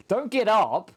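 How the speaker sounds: high, loud yell of protest; exasperated shout. Real, not acted. Player fed up after the long walk, exasperated shout. Frustrated shout at teammate in game.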